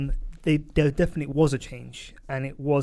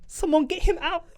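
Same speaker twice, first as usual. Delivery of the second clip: mock quote; 14 semitones higher than usual. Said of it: "when he goes".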